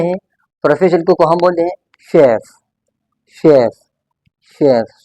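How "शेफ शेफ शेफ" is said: careful teacher's "शेफ"; correct pronunciation. Shown as a demonstration. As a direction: model "शेफ" in separated repeats. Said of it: "all about the pronunciation of this word".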